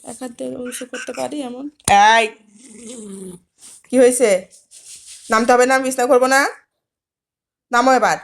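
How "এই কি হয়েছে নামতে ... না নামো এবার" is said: loud, sharp scolding. Real, not acted. To the pet refusing to get off the bed, a loud scolding. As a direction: scold it loudly so it finally obeys. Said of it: "বকা না দিলে তো কথা শোনো না".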